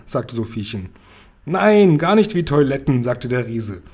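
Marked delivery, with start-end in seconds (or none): 1.4-3.0 louder, emphatic giant contradicting; the giant insisting he is right. A narrator's reading.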